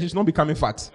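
usual speaking voice

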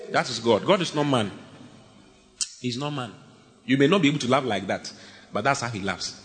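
usual speaking voice

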